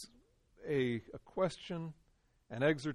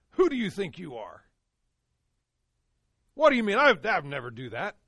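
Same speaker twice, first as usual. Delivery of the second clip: louder, higher indignant retort; defensive outburst; 4 semitones higher, 10 dB louder than usual. Retold or imitated. A person rebuked for sin, snapping back in offended anger. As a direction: bristle back at the accuser.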